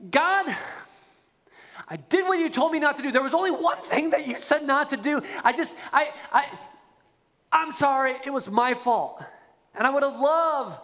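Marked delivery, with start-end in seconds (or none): 0.1-9.2 earnest confession. Retold or imitated. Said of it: "had simply just said".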